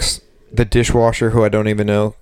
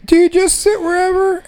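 much higher, whiny customer voice; clueless customer. Retold or imitated. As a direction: pipe up like a lost tourist. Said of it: "people come up to the bar".

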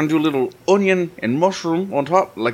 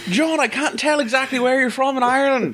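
hammed-up Irish lilt, pitched higher; mock Irish accent; 11 semitones higher than usual. Host teasing John in a mock Irish accent. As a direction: put on a jokey stage-Irish voice. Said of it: "I'm not doing this voice".

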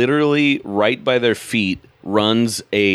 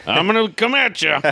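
mock tough guy; 12 semitones higher than usual. Comic acting aggressive stage persona.

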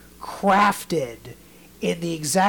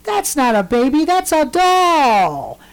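put-on higher, louder, slow kid voice; Harry correcting grandma, higher and slow; blunt boy; 9 semitones higher, 8 dB louder, slower than usual. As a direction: spell it out slowly like a blunt little boy. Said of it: "And Harry goes".

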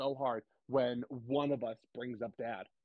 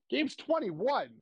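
high, loud, drawn-out disbelief; astonished realization; 10 semitones higher, 5 dB louder, much slower than usual. Real, not acted. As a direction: exclaim it slowly in amazement, pitch shooting up. Sudden realization of sibling's age.

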